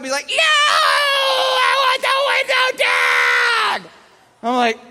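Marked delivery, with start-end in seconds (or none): 0.3-3.9 very high, loud, slow wail; hammed-up screeching demand; crying toddler tantrum. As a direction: scream each word, stretched out, like a toddler. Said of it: "He's gonna cry either way".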